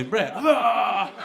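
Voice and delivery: hammed-up groan after each name; drawn-out climax "ah" after the shout; acted climax cries. Acting out repeated climaxes.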